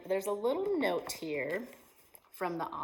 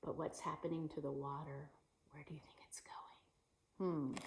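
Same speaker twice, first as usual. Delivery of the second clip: mysterious whisper; 3 semitones lower, 9 dB quieter than usual. Acted. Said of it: "whisper to child audience".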